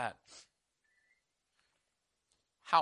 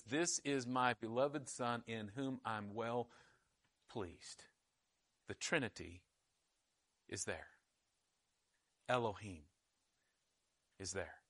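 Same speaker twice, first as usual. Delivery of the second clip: low, hushed, reverent delivery; solemn preaching; 6 semitones lower, 11 dB quieter than usual. Real, not acted. Preacher showing the Trinity at the baptism, solemn hush. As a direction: let each short phrase settle softly in long pauses.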